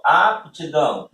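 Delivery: "aptidão" slow, in three clear beats; teacher sounding out "aptidão" slowly, syllable by syllable; three-syllable pronunciation. Shown as a demonstration. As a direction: stretch "aptidão" into ap-ti-dão with a separate p. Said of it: "seriam três sílabas".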